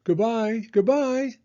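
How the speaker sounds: high, loud goodbye called out; crying out goodbye. A narrator's reading. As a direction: call it out as they leave. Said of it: "cried out".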